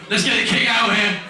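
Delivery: high, shouted announcer's call; rousing stage introduction. Real, not acted. Host bringing the King out, high rousing shout. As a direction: shout the star out to the crowd.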